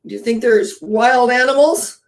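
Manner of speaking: put-on a girl spooked in the forest; Dorothy asking the others, mildly frightened; scared Dorothy. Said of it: "feeling kinda scary".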